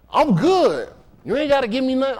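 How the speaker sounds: mocked neighborhood acquaintance. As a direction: imitate him, pitch lifted.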